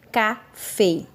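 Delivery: Café said with the stress on fé; stress on last syllable. Shown as a demonstration. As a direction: hit the final syllable of Café clearly. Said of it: "Prestem atenção na palavra café". (Café com a sílaba fé bem marcada)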